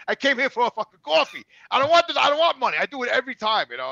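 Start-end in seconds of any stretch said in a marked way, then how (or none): 0.1-2.8 raised, louder rant that keeps building; mock rant at machine. Customer ranting at the card machine, louder and louder. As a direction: vent at the little screen, getting louder.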